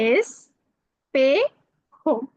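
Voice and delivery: "espejo" drawn out as es... pe... jo; syllable by syllable. Shown as a demonstration. Teacher models a Spanish word for learners, syllable by syllable. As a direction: say "espejo" very slowly with a pause after each syllable.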